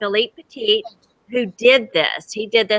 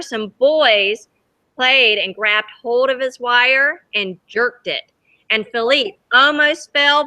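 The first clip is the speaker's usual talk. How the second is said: reading a picture book aloud to the class; lively read-aloud for kids; storybook reading; 2 semitones higher than usual. A narrator's reading. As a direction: tell it gently to children, letting the wonder show. Teacher reads story to children.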